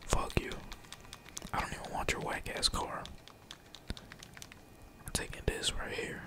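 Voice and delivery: ordinary delivery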